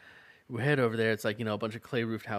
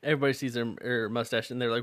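normal talking voice

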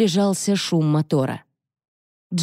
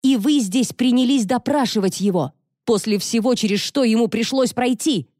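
furious daughter, raised pitch; angry; 6 semitones higher than usual. A narrator's reading. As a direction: lash out in outrage. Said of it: "Джонни уже охватила ярость".

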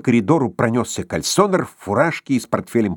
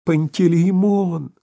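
trembling voice; 7 semitones higher, slower than usual. A narrator's reading. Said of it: "трясущимся голосом".

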